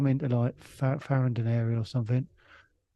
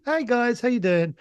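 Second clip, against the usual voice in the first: high, louder cheery greeting; upbeat; 11 semitones higher, 4 dB louder than usual. Retold or imitated. Upbeat friend bobbing in to greet his mates, high and cheery. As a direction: pitch it right up, all friendly bounce. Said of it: "really upbeat".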